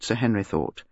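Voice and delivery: ordinary delivery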